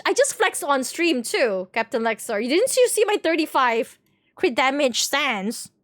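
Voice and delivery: ordinary delivery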